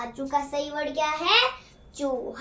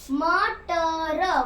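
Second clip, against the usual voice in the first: drawn-out word; much slower than usual. Shown as a demonstration. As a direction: stretch the word out very slowly. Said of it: "teacher stretches answer".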